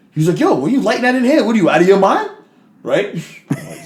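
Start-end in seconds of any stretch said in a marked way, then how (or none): 0.4-2.3 quick, high-pitched scolding; imitated friend's indignant outburst; incredulous challenge. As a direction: challenge him in disbelief, pitch up. Chase confronts man lighting up indoors.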